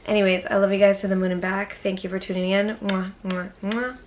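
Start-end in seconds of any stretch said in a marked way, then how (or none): 2.8-4.0 soft smacked kiss sounds; three quick mwahs, softly; blown kisses. Real, not acted. Vlogger signing off to viewers with blown kisses.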